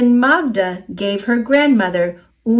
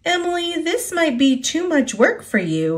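teasing; 8 semitones higher than usual. A narrator's reading. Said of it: "he teased".

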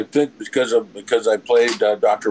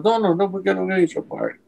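placating producer; 11 semitones higher than usual. Retold or imitated.

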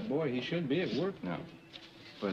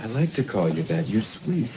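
loud; 9 dB louder than usual. Acted. Flirting man, loud.

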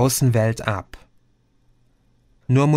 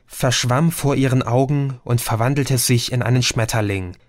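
ordinary delivery

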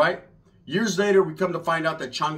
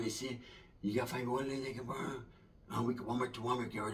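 laid-back homie; 3 semitones lower than usual. Retold or imitated. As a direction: mumble it low and easy. Laid-back brother voiced.

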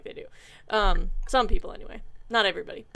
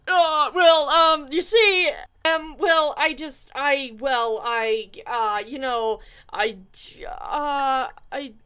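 flustered stammer; 2 semitones higher, 6 dB louder, much slower than usual. Acted. Asked why he never kissed her, a loud flustered stammer. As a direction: trip over every filler.

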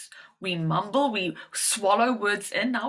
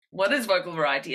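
ordinary talk

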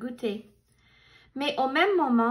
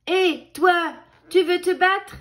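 louder, higher picking a fight; loud high challenge; 6 semitones higher, 7 dB louder than usual. A narrator's reading. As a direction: shout the dare up high. Grumpy ladybug challenges beetle.

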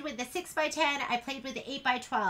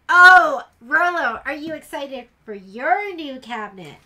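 loud, bright call to a pet; calling the dog; 16 dB louder than usual. Real, not acted. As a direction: burst out loud to get the dog's attention. Calling excitedly to her dog.

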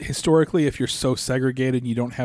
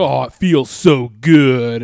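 slow, louder mock gloat; gloating shirt slogan; 9 dB louder, much slower than usual. Mocking pro-Trump souvenir shop shirts, slow and loud. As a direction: drag it out smugly like a souvenir slogan. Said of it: "saying, like".

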